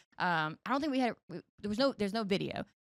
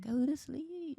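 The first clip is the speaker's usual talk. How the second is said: smooth sleepy voice; 7 semitones higher, much slower than usual. Shown as a demonstration. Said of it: "I got that smooth voice".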